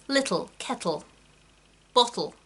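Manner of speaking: RP accent with little, kettle, bottle, side-released t; crisp RP accent demo. Shown as a demonstration. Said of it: "I haven't done an R P accent".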